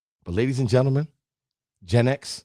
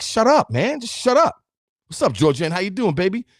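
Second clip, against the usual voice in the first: swat the thought away, higher; mock brush-off; 8 semitones higher than usual. Telling his negative thought to shut up, high mock brush-off. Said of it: "I'm like".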